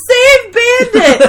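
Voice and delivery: high, slow laughing plea; dramatic Angela. Retold or imitated. Angela throwing her cat up, slow high mock plea. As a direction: wail it as a tragic last wish.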